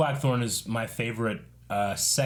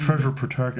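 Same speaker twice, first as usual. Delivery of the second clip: Nick Cage impression attempt; 3 semitones higher, 3 dB louder than usual. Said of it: "I can't do Nick Cage. I'm trying".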